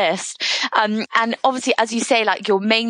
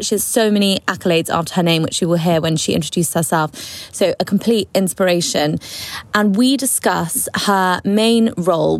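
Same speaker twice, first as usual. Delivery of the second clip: usual speaking voice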